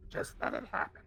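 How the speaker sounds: whispery goblin hiss; breathy creature whisper. Acted. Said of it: "can you say it not goblin for me".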